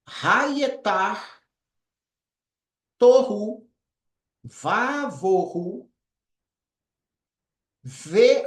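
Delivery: chant-like careful reading; slow Hebrew reading. Shown as a demonstration. Teacher reading the verse aloud for students, slow. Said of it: "Vou estar fazendo a leitura".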